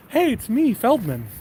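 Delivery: high, singsong hello into the intercom; courteous, lilting caller's voice; polite Feldman calling up. Retold or imitated. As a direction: call up sweetly, asking to be let in. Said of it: "He'll call up to Bizarro Jerry".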